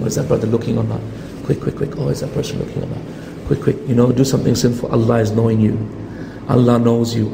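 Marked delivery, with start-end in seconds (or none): 0.0-3.8 furtive whisper, much softer; sneaky whisper. Pretend. Someone sinning at a computer, whispering nervously. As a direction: hiss it like you're afraid of being caught. Sinner sneaking, checking who watches.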